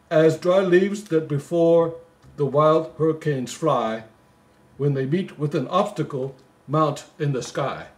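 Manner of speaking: slow, lilting Christmas poem reading; poem recital. Acted. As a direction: read it like a bedtime Christmas poem.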